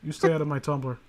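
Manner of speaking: normal talking voice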